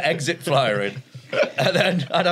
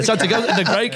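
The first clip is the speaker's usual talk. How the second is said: usual speaking voice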